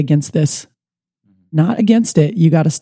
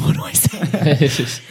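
slow, softer worried aside; nervous aside; 6 dB quieter, much slower than usual. Retold or imitated. Listening to the player on the phone, in a slow nervous aside. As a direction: mutter it slowly, unsure. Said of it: "I was getting nervous".

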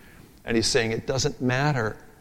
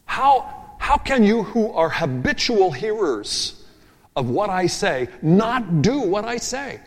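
higher, slow, pleading; slow incredulous plea, higher voice; pleading rhetorical question; 8 semitones higher, slower than usual. Acted. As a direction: ask it slowly with raised pitch, incredulous. Preacher's pointed rhetorical question.

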